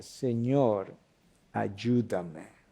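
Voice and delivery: soft and low, ayúdame nearly breathed out; quiet desperate plea. Retold or imitated. Believer on their knees in trouble, quiet plea. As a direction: cry out softly from the heart. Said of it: "y clamaste".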